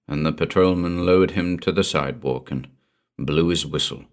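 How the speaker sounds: usual speaking voice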